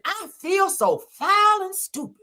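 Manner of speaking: high, slow mock confession; hammed-up preacher regret; mock pastor voice. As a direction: whine it out in his voice. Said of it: "So he said".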